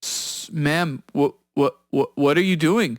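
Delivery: imitated stammering, baffled scammer; flustered stammer. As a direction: stumble over the words, caught off guard. Retold scammer flustered at being hacked.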